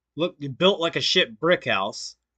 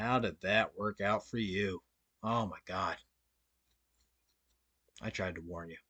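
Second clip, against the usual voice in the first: quiet mock pity; 6 semitones lower, 12 dB quieter than usual. Streamer fakes pity for a rival, low and soft. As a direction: sigh it out as if you saw it coming. Mocking a rival after his failure.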